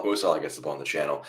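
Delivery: normal talking voice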